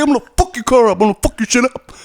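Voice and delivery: high, loud tough-guy threat; mock threat. Neighbor threatening to wreck his car, hammed-up angry yell. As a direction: sneer it like a street bully.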